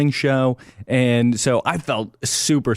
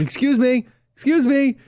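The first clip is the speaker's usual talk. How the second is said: high polite excuse me; stranger calling out; 12 semitones higher than usual. Retold or imitated. Fan calling after Trey, high polite voice. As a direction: call after him in a high voice. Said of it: "came up from behind me and was like".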